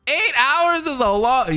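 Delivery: slow, very high-pitched disbelief; incredulous. Real, not acted. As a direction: exclaim it high, stretched out in shock. Shocked at an eight-hour review.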